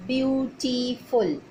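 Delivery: beautiful drawn out slowly, syllable by syllable; teacher stretching beautiful so pupils hear each syllable; slow syllable demo. Shown as a demonstration. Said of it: "three syllables".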